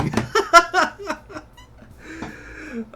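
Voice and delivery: real laugh, squeaky and high; high-pitched giggle. Real, not acted. Laughing at own anime voice.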